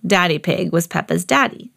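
ordinary delivery, nothing marked

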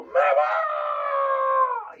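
very high, loud piercing shriek; demon's departing shriek. Retold or imitated. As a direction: let out the demon's final scream.

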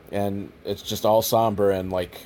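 usual speaking voice

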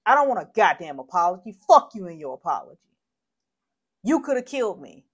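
outraged, forceful cursing; furious rejection. Real, not acted. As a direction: spit the apology back at them. Rejecting shooter's imagined apology.

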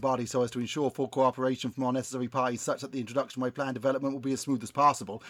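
put-on rattled off quickly in a West Country accent; fast West Country patter. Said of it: "best West Country accent".

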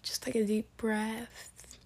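slow soft whisper. Real, not acted. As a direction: breathe it out, slow and hushed. Guiding listeners to relax.

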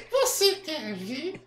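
high, very slow puppet voice; Kleber. Acted. Puppet offering the host a demonstration, high puppet voice. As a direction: stretch the offer out as a squeaky dummy. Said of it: "acha uma voz que pareça com o boneco".

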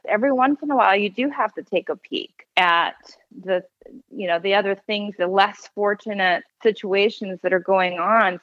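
usual speaking voice

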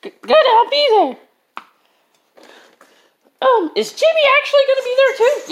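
put-on squeaky high-pitched kid voice; fast, high cartoon kid; high character voice.

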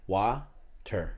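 "Water" split in two, wah... ter; sounded-out water. Shown as a demonstration. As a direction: break "Water" into two slow syllables with a hard T. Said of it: "you know how you say wawa?".

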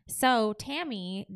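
ordinary talk, nothing marked

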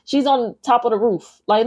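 usual speaking voice

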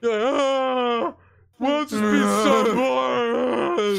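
loud, slow wookiee wail; Chewbacca impression. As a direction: howl it like Chewbacca. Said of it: "My Chewbacca impression".

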